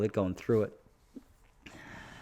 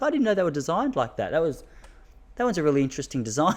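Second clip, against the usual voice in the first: higher, wide-eyed mock wife voice; imitating wife; 6 semitones higher than usual. Wife marvelling at husband in the bathroom, higher mock voice. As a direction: play the wife, innocently curious and higher.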